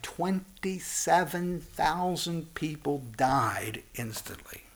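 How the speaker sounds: slow, breathy whisper of the death toll; hushed whisper. Real, not acted. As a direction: whisper it slowly, letting the horror sink in. Tells of a deadly collapse.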